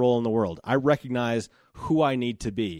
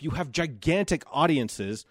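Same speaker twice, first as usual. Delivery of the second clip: ordinary delivery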